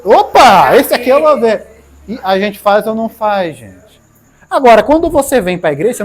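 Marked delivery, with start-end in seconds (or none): 0.0-1.8 loud mock eager Opa; loud, eager viewer spotting the success video; mock excited find. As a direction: grab onto the success video greedily. Mock YouTube browser excited.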